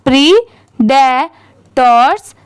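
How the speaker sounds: Predators drawn out slowly, part by part; slow syllable-by-syllable. Shown as a demonstration. Teacher joins three parts for beginners, slow syllable-by-syllable. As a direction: say predators in slow, separated chunks. Breaking word up before speeded reading.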